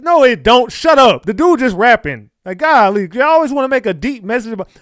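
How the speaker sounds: exasperated yell. Real, not acted. Fed up with fan theories, shouting them down. Yelling back at fans.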